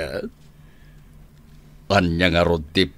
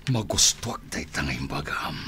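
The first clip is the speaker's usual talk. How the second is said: ordinary talk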